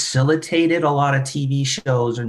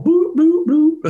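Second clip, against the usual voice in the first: high-pitched comic boops; Michael Winslow; 4 dB louder, slower than usual. Retold or imitated. Host playing a sound-effects actor in a cop film, high boops. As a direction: imitate silly sound effects with the voice. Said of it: "And Michael Winslow go".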